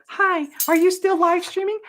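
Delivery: very high, loud put-on voice; YouTube's voice. YouTube checking on the streamer, high pestering voice. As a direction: pester the streamer in squeaky falsetto. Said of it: "YouTube's like".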